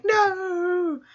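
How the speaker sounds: high, wailing cry of no; a sudden high despairing cry. Retold or imitated. Dreaming boy crying out at the yokai, high and wailing. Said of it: "and he's like".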